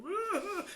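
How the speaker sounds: a high, loud mock sob of breaking down; imitated high crying "Ah"; imitated crying wail. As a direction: wail like the tough friend finally cracking. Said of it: "he's like".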